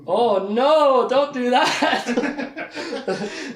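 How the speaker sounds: drawn-out mock whine, half laughing; hammed-up oh no said through a laugh; mock dismay. Mock reaction of the group.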